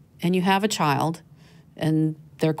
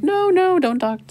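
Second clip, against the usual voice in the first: mock panicked stop; 14 semitones higher, 5 dB louder than usual. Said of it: "Mark's like".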